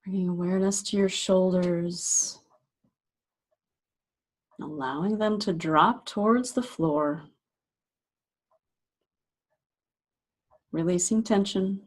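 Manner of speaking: unhurried meditation guide, long pauses between phrases; guided meditation. Acted. Meditation teacher guiding listeners to relax shoulders and jaw, guided meditation voice. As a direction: gently talk them into settling, leaving space after each phrase.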